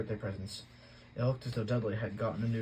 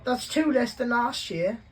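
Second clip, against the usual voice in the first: Dudley whining; 13 semitones higher, 8 dB louder, slower than usual. A narrator's reading. Spoiled boy complains to parents.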